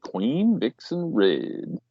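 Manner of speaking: drawn-out echo. Acted. Co-host echoing the Queen Vixen Red name, drawn out. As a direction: stretch out every word of the name.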